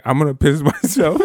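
high and loud, cracking into a laugh; laughing plea. Retold or imitated. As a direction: beg while laughing at it. Kid begging teacher, laughing retelling.

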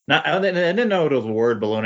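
ordinary delivery

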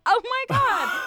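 high, loud, mock-astonished shout; shocked exclamation. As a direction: cry out as if stunned by the reminder. Acting out own shocked reaction.

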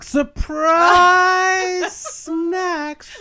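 long, loud, high drawn-out shout with a laugh. Pretend. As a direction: belt it out like a game show reveal. Announcing the surprise snacks segment.

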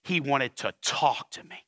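low, slow and weighty on "talk"; ominous emphasis. Retold or imitated. Pastor telling wife how he said "talk", ominous. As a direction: drag out "wanted to talk" like dreadful news. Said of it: "seen the way he said it".

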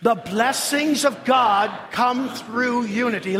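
slow, emphatic congregational declaration; like you mean it. Shown as a demonstration. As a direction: proclaim it slowly with full conviction. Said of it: "say it like you mean it".

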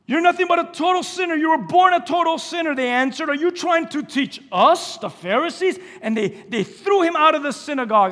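loud, high fiery preaching; preaching at full force. Acted. Pastor preaching the healed blind man story, fiery and loud.

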